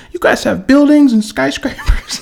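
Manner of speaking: high laughing astonished voice; mock amazed lady. Lady seeing downtown pictures, high and amazed. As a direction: gasp it like a clueless tourist. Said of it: "She said".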